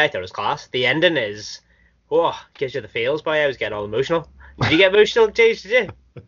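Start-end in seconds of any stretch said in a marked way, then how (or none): 4.6-6.2 teasing. Acted. Teasing friend about getting emotional.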